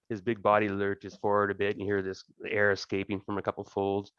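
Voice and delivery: ordinary talk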